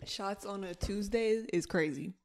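ordinary talk

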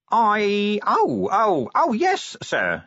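very high, stammering realisation; flustered Nobby. Acted. Nobby stalls under Vimes's questioning, flustered and high.

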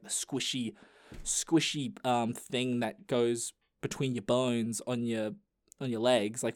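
ordinary delivery

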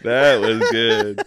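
loud, drawn-out words through laughter; laughing hard. Real, not acted. Reacting to friend's botched Chewbacca, loud helpless laughter. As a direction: crack up while saying it slowly. Cracking up at failed impression.

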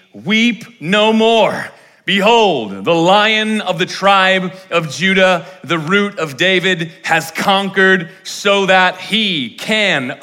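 slow, high and loud declaration; preaching proclamation. Acted. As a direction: announce the Lion like a triumphant herald. Proclaims the elder's words.

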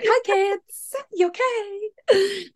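put-on very high sing-song voice to kids; awkward cheery greeting. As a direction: squeak out a mock-innocent hello. Retold greeting to passing kids.

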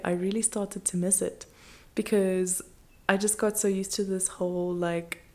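ordinary talk

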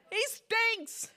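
high-pitched mock dismay; protesting sister. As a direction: exclaim it high, as the appalled sister. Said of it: "Lazarus's sisters said".

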